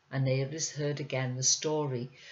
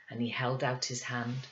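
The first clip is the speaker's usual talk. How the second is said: ordinary delivery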